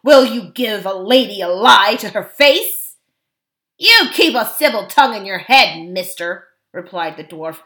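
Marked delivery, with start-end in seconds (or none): 0.0-3.0 loud, outraged king; forceful, chivalrous outrage; indignant rebuke. A narrator's reading. 3.8-6.5 loud, threatening dwarf retort; gruff dwarf talking back; insolent dwarf. A narrator's reading.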